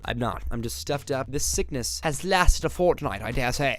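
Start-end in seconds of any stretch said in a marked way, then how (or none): 1.3-3.8 mock British.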